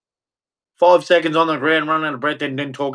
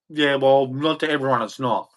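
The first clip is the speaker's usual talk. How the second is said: ordinary talk, nothing marked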